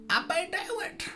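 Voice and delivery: squeaky put-on voice; high silly voice. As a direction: repeat it in a squeaky cartoon voice. Repeat in a far high voice.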